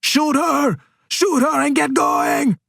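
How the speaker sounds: high, stretched-out shout; calling back. Acted. Pelayo to Casper while running from gunfire, calling back. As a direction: shout an urgent order behind you. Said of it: "and he calls back".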